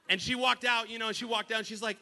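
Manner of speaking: ordinary talk, nothing marked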